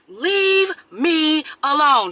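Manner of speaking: slow, high, word-by-word insistence; emphatic demand. Acted. Telling a man she's with her kids, firmly exasperated. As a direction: spell it out, one drawn-out word at a time.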